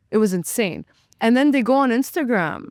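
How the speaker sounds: normal talking voice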